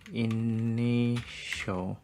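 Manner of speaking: very slow, softly stretched syllables; drawn out while typing. Real, not acted. Coder typing the text-transform value aloud, very drawn out. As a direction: stretch the word out as you type.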